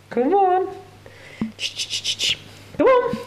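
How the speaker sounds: very high coaxing squeak; calling a pet. Real, not acted. Owner calls the reluctant cat, coaxing very high. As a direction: beckon the cat in a tiny high voice.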